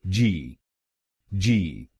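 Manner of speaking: जी as ji with a long i; last syllable alone. Shown as a demonstration. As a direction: clear जी, ji. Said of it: "आखिरी शब्दांश को बोलिए".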